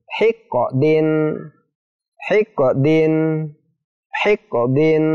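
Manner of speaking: "Hiqqadin" with q bounced, like hi-ko-din; qalqalah bounce. Shown as a demonstration. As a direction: let the q of "Hiqqadin" echo briefly before din. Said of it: "ada pantulannya ya".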